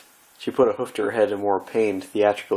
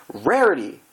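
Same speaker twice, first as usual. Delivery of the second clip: half shout; 8 semitones higher, 5 dB louder than usual. Acted. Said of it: "Twilight half shouted".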